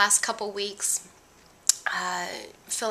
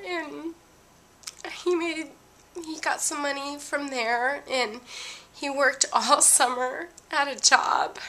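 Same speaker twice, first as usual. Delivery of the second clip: real tears, voice pushed higher and breaking; fighting back tears while telling it; tearful, crying; 5 semitones higher than usual. Real, not acted. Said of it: "I'm sorry I'm crying".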